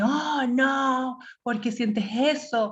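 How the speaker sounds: exclamación dramática, más aguda; dramático. Shown as a demonstration. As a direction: alarma exagerada de madre. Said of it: "no dramatizamos". (madre que dramatiza ante los sentimientos del hijo, aguda)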